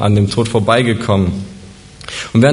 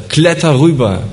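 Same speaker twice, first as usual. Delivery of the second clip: urgent call, higher and louder; shouted command; 5 semitones higher, 3 dB louder than usual. Acted. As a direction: yell it across the gap to the stranded man. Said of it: "Und dann schreit er".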